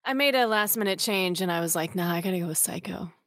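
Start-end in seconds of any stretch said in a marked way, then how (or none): 2.0-3.1 whispered retold thought; breathy private aside. Retold inner decision.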